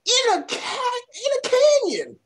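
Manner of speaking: high-pitched disbelief; incredulous outburst. Real, not acted. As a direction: blurt it out, voice leaping up in disbelief. Incredulous rant about the film.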